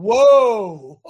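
a loud horse-stopping whoa. Shown as a demonstration. Host stops a horse with a loud whoa. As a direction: call whoa like reining a horse. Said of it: "Not whoa, Nelly".

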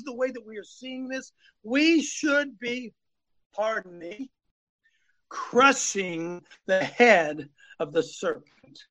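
preaching at full force, rising high and emphatic; fiery preaching. Acted. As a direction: hammer each question home to the congregation. Fiery sermon to congregation.

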